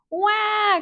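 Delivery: high mock baby cry; hammed-up infant wah; baby wail. A narrator's reading. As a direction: wail like a newborn. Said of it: "cried Biddle".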